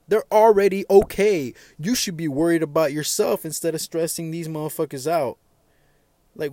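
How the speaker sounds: loud, high, fed-up yelling at complainers; shouting down whiny people online; angry rant. Real, not acted. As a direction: let it rip, fed up and shouting.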